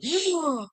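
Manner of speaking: sharp high gasp of shock, acted out. Reliving the moment he saw the likes, a sharp high shocked gasp. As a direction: suck in air like you can't believe it. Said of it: "like, shocked".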